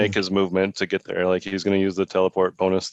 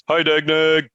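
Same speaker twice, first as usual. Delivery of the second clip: a cheerful call across the square, pitched up; calling out loudly; 7 semitones higher, slower than usual. Acted. Gashar spotting his orc friend in the crowd, calling out. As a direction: shout a greeting to a friend from afar. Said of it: "He calls out pretty loudly".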